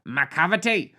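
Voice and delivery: exclaimed in a comic Scottish voice; Scottish accent. Acted. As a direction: put on a Scottish accent, complaining to a dentist. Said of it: "sounds like a Scottish person telling a dentist".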